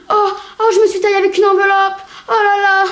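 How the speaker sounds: high, fast, hammed-up whining; mocking boys who think they will die. Mocking a boy's fuss.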